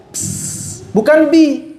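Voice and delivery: usual speaking voice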